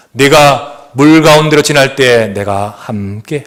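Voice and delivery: 느리고 장중한 성경 낭독; solemn scripture reading. Acted. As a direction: intone the verses slowly, with weight. (장엄하게 성경 봉독)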